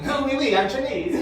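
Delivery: a hint of a mock French accent on "oui, oui". Shown as a demonstration. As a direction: tease with a silly French accent. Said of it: "it's a fucking French accent".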